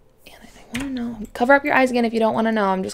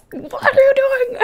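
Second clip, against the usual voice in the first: high incredulous quote to a coworker; retelling her own shocked reaction, much higher; mock disbelief; 7 dB louder than usual. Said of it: "I was like".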